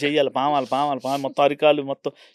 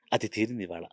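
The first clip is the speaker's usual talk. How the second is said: ordinary delivery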